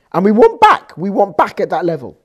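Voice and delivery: loud, pleading cry; fervent plea. Real, not acted. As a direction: call it out with longing. Preacher's rising plea.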